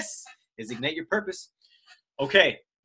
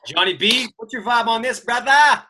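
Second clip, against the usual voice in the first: calling over; 7 semitones higher, 8 dB louder than usual. Real, not acted. Handing over to co-host.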